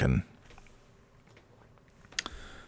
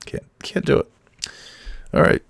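ordinary delivery

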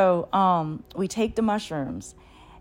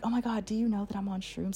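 hushed to a fawn; 3 semitones higher, 7 dB quieter than usual. Retold or imitated. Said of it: "I'm like, talking to it".

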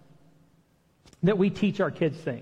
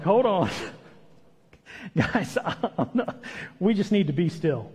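chuckling through the words, lightly voiced; laughing Moses. Moses laughing to the people at the sea. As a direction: laugh it off gently. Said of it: "Moses is like".

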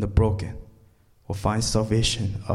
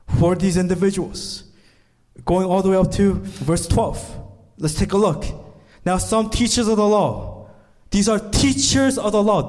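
ordinary talk

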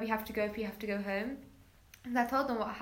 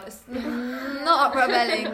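much louder and slow, each word pressed; emphatic protest; 2 semitones higher, 10 dB louder, much slower than usual. Real, not acted. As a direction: put your foot down on every word. Protesting school dress rules.

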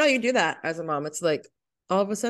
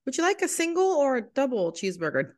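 higher, singsong fast-food server imitation; order-taker voice; 7 semitones higher than usual. Server asking customer at drive-through, higher-pitched order-taker voice. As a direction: play the polite order taker.

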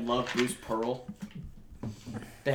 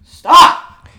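sudden high, loud yell of Stop; coach yell; 13 semitones higher, 19 dB louder than usual. Retold or imitated. Friend imitating the Auburn coach yelling at players, full shout. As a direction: bark it like an angry coach on the sideline. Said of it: "What does he say?".

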